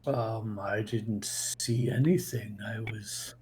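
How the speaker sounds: scared whisper to the detective; whispered answer. Acted. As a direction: breathe it out in a frightened whisper. Nervous NPC questioned by detective.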